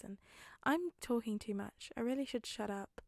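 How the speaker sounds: normal talking voice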